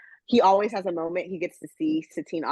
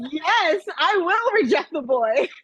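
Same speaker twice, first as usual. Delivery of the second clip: dramatic declaration; 12 semitones higher, 5 dB louder, slower than usual. Retold or imitated. Quoting castmate, joking.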